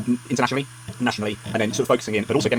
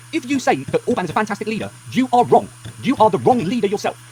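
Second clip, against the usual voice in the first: higher and louder, jabbing every word; angry; 9 semitones higher, 4 dB louder than usual. Real, not acted. Vlogger scolding Orban fans, angry and loud. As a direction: scold them, angry and rising. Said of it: "why I'm so angry today".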